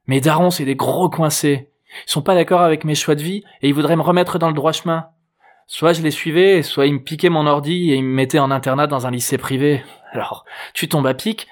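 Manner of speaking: a hint of a put-out girl; exasperated teen girl. A narrator's reading. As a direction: vent about your parents as a teenage girl. Narrator plays Juliette venting about parents.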